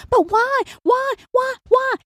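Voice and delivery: high-pitched, repeated mimicking whine; mock pestering. Imitating someone who won't take no, mock pestering.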